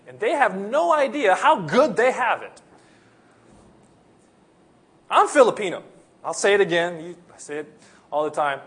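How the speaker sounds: ordinary talk, nothing marked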